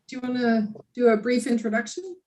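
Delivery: normal talking voice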